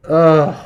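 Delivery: loud, frustrated "ugh"; real groan of exasperation; exasperated groan. Real, not acted. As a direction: let out a fed-up groan. Page will not load, streamer exasperated.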